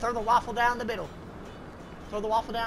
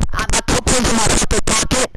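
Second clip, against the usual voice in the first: much louder, fed-up shout; yelling at a teammate who won't listen; exasperated yell; 12 dB louder than usual. Real, not acted. Yells at teammate he said it.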